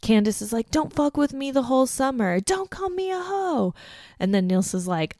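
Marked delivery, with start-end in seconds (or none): 0.7-3.7 mock screaming. Candace screaming at Amy.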